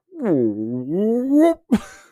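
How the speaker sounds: loud rising "woooop" gurgle noise; swooping, loud stomach-noise impression; imitated stomach rumble. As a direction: mimic a gut rumble with a long upward swoop. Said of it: "It's like".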